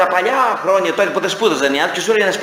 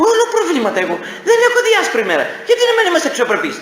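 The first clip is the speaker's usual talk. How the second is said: mock grumbler; 12 semitones higher, faster than usual. Whiner telling a friend his troubles, mock self-pity.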